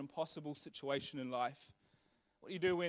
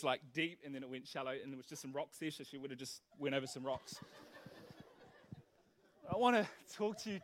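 ordinary delivery

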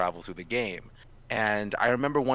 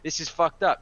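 much higher, louder, half-laughing disbelief; shocked outrage; 9 semitones higher, 6 dB louder than usual. Retold or imitated. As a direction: react to the best friend leaving too, stunned and indignant.

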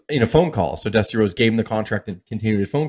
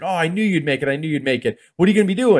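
imitated delighted dad, higher voice; proud excitement; 6 semitones higher, faster than usual. Father hears his son got hired, excited and higher. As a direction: gush with pride, rising into the question.

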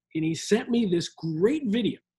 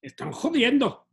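put-on accent from Spain, exclamations pitched up; Castilian Spanish accent; 4 semitones higher than usual. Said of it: "that's one good accent that you got going".

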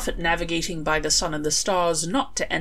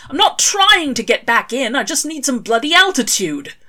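higher, louder exasperated retort; irritated shout; 7 semitones higher, 6 dB louder than usual. A narrator's reading. Crawley yelling up at the storm cloud, irritated. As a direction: yell up at the cloud in annoyance.

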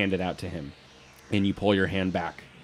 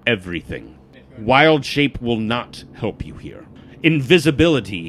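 grave warning; 3 semitones higher, 7 dB louder than usual. Acted. Warns Matthias wild shape won't help, grave and emphatic. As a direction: hammer home that there is no way round.

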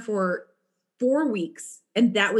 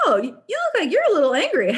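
jokey manager; 7 semitones higher, 3 dB louder, much faster than usual. Retold or imitated. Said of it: "this kind of jokey guy".

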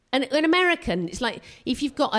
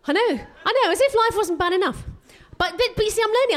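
excited; 12 semitones higher, 4 dB louder, faster than usual. Real, not acted. Defensive comic protest.

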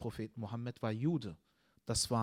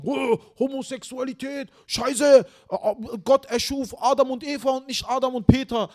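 much higher, louder, hammed-up voice of an ignorant teen; mocking clueless teens; 12 semitones higher, 14 dB louder than usual. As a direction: parody the dumb kids ranting, loud and high. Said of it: "die sagen". (spöttische Nachahmung ahnungsloser Teenager, viel höher und lauter)

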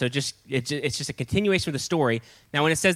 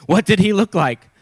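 loud, higher-pitched demand; 5 semitones higher, 7 dB louder than usual. A narrator's reading. King questioning his messengers, demanding. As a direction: press the question hard and loud. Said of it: "the king demanded".

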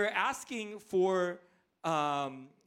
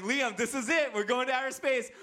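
put-on higher-pitched hype for a child; excited dad; 6 semitones higher, 4 dB louder than usual. Dad tells Liam they're going to space, excited. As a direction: pump up your little boy. Dad hyping son before ride.

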